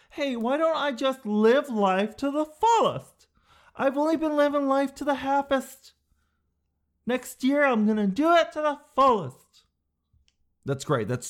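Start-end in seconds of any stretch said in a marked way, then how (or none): 0.1-5.9 mock enthusiastic resolver. Comic mocking the resolution to live life fully, goofy high voice. Mocks a vague resolution as a character. 7.1-9.7 mock enthusiastic resolver. Comic mocking the resolution to live life fully, goofy high voice. Same mocked resolver vows again.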